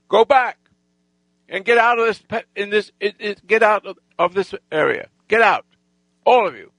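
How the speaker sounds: high, emphatic dismissal; commanding order. Retold or imitated. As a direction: send a whole gang packing. Retold order to the gang to leave.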